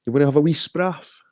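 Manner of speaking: lifted pitch on the second take. Shown as a demonstration. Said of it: "spraff's a Scottish word for".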